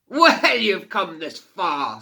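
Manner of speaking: loud, high, slow scornful boom; mocking fire fiend. A narrator's reading. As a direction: sneer down at the tiny girl.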